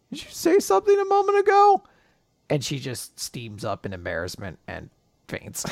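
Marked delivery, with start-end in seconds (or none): 0.1-1.8 squeaky high question; coy high-pitched tease; high teasing voice. Retold or imitated.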